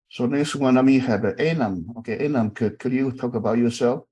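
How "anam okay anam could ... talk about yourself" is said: ordinary talk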